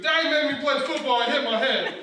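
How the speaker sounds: loud, high tattling wail; imitated tearful kid telling on dad; mock crying child. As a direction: wail it out like a hurt little kid. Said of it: "He starts crying".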